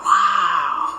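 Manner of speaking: loud awestruck shout; amazed. Acted. Seeing the event site, a loud amazed wow. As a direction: let it burst out at the sight.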